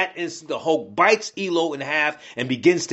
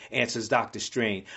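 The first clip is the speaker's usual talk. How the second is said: ordinary delivery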